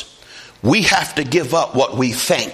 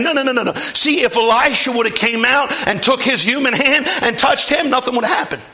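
full-force preaching; 11 semitones higher than usual. Acted. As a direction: hammer the point from the pulpit. Preaching Elisha point.

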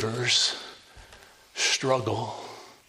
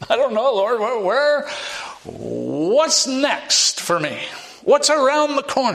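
anxious plea; 10 semitones higher, 4 dB louder than usual. Acted. Unsure believer asking the Lord what comes next, anxious plea. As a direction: cry out to the Lord, mock-bewildered.

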